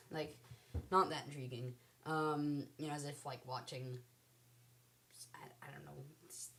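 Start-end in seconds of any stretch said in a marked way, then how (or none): 5.3-6.5 whispered unsure aside; whispering. Real, not acted. Trailing uncertain aside.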